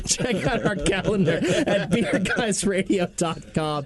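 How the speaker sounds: slow, laughing through the words. Real, not acted. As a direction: giggle while reading it.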